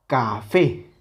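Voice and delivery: "café" with punched final "fé"; acento en la última sílaba. Shown as a demonstration. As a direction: land hard on "fé" in "café". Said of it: "con esa intensidad en la última sílaba". ("café" con fuerza en "fé")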